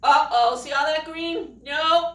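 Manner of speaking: usual speaking voice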